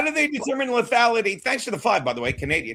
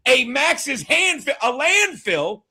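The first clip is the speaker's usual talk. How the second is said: a landfill said slowly with weight; mock remembering, slow; slow emphatic; 2 semitones higher, much slower than usual. Real, not acted. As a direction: land each word slowly. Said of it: "let me see if I can remember again".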